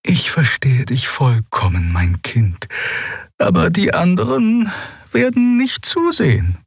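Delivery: put-on tender, high-pitched elderly healer; gentle explaining. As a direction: reassure gently in a high character voice. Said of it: "Sanft erklärte sie Lien".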